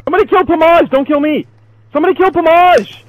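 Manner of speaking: high, pleading call for help; urgent calling out. Real, not acted. Player rallying others against Pomage, urgent high call.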